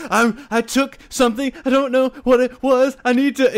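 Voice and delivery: high, loud, panicked rush of words; pushing too close and begging for help; frantic pleading. Retold or imitated. Patient panicking at the triage nurse, high frantic plea.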